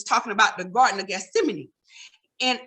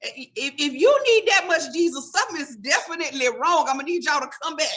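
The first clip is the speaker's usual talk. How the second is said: incredulous reply; 5 semitones higher than usual. Retold or imitated. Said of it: "I'm like".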